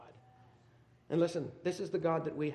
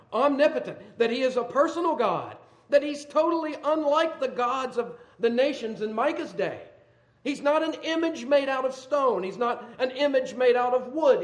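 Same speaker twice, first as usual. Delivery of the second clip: loud, high preaching voice building to a question; fiery preaching; 8 semitones higher, 8 dB louder than usual. Acted. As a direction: preach it hard to the congregation. Preaching at full force.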